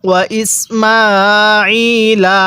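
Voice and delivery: alif drawn out long, very slow chant; measured Quranic chant; slow Quran recitation. Shown as a demonstration. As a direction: recite it slowly, stretching the long vowel. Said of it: "তাহলে কিভাবে পড়ব?".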